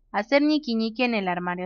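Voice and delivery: soft, settled read-out; composed re-reading. Real, not acted. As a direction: read it quietly, composure regained. Calmer re-reading of the same option.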